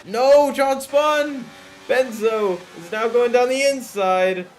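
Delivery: loud high excited yell; excited shout. Real, not acted. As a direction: shout it as the car spins. Spin seen live on track.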